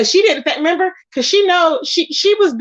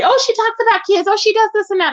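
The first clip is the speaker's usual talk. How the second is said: much higher, sarcastic put-on voice; mocking parody; 7 semitones higher than usual. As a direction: ham up her gossip in a silly voice. Mocking what Five Babe told everyone.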